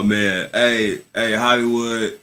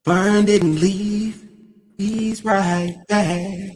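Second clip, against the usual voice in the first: much higher, very slow, stretched announcer call; announcer hype; 11 semitones higher, much slower than usual. Acted. Host telling the chat Vern is back, drawn-out announcer style. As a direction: announce his return like a ring announcer. Hyping Vern's return like announcer.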